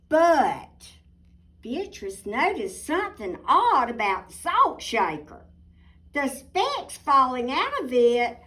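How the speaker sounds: put-on lively storybook narration for kids; reading to children. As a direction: tell it like a bedtime picture book. Reading picture book aloud.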